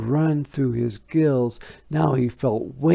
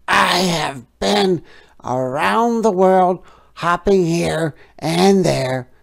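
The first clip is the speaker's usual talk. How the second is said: higher, louder frog voice; boasting frog; 7 semitones higher, 8 dB louder than usual. A narrator's reading. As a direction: tell it grandly as the frog. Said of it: "said the frog".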